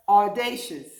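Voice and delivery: audacious said clearly, loud, stress on DA; word said slowly together. Shown as a demonstration. Teacher leads the class saying audacious. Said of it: "One more time".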